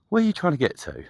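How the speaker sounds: high, bright-voiced eager offer; eager question. Asking the homeless man where he's going, eager and high. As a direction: pitch it up like an eager helper.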